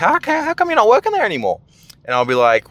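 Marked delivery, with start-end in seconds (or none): 0.0-1.6 much higher, quick mock uncle voice; imitating my uncle. Imagining the uncle asking at Christmas, high mock voice. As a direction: squeak out the uncle's question. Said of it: "He's gonna like".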